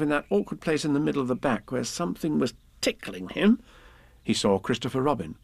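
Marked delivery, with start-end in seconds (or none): none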